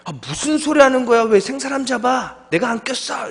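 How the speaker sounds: wronged friend loudly protesting he didn't fart. Retold or imitated. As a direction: protest the false blame, voice climbing. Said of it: "억울하니까 소리가 커집니다".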